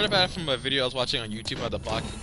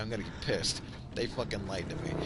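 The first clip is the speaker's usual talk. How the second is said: ordinary talk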